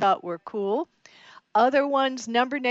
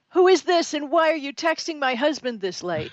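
suspicious wife; 6 semitones higher than usual. Retold or imitated. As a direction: demand answers from the stranger. Suspicious wife confronts texter.

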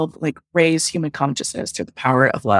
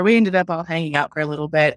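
usual speaking voice

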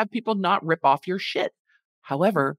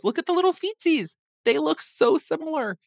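cutesy coo; 9 semitones higher than usual. Real, not acted. Cooing over cake's feet.

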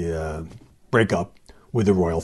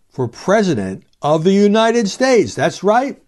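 higher and louder, dramatic disbelief; incredulous reveal; 9 semitones higher, 6 dB louder than usual. Real, not acted. Host revealing Markle presidential talk, incredulous. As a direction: land it like an absurd punchline.

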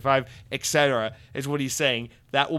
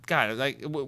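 usual speaking voice

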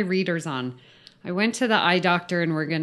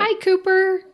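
high, louder coo to a dog; dog greeting; 14 semitones higher, 6 dB louder than usual. Real, not acted. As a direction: greet a dog in a high sing-song pitch.

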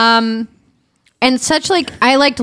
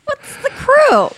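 puzzled; slower than usual. Real, not acted. Puzzled at the crew.

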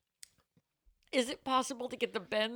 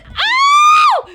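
sudden piercing scream, far higher and louder; real scream; 18 dB louder than usual. Real, not acted. Drink splashes on host, real startled scream. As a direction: shriek in shock. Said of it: "I screamed".

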